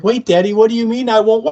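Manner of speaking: put-on high, small child's voice; little girl voice. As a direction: play a frightened little girl. Acted little girl's question.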